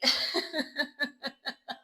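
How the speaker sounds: a quiet, breathy chuckle; soft laugh. Real, not acted. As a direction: laugh at yourself. Laughs at her own joke.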